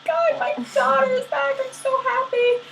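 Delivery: very high-pitched joyful squeal; hammed-up elation; excited. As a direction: squeal it like an overjoyed mother. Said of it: "Demeter was just so excited".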